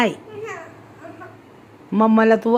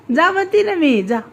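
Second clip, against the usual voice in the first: higher-pitched scolding push; exasperated order; 6 semitones higher than usual. Real, not acted. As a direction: say it higher, fed up, to make her go. Exasperated mother ordering child. (मुलीला वर जायला सांगणारी वैतागलेली आई)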